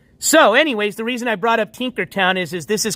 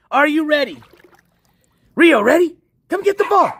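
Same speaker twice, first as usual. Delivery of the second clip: excited dog call; 8 semitones higher than usual. Real, not acted. Calling the dog to jump.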